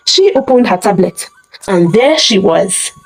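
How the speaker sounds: usual speaking voice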